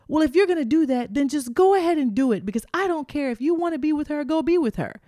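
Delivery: high-pitched, bluffing comeback; acted-out indignant dare; mock defiance. Acted defiant reply to his ultimatum.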